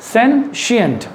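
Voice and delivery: sentient said slowly as SEN-shient; model pronunciation. Shown as a demonstration. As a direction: repeat sentient with a clear sh sound. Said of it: "तालबेशो आएगा आपके मुंह में".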